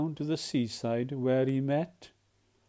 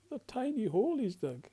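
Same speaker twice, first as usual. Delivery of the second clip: ordinary delivery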